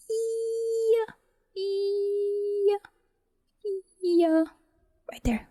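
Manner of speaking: usual speaking voice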